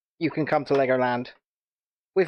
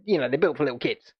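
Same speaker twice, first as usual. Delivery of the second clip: ordinary talk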